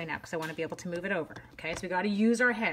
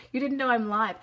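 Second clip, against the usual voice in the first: normal talking voice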